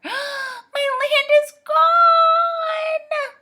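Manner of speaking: very high, slow, drawn-out wail; shrieking. A narrator's reading. Saucepan man finds his land missing, shrieking. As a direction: shriek it, stretching the last word. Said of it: "shrieked the saucepan man".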